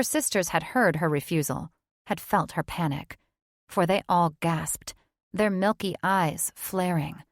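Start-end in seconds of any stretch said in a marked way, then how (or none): none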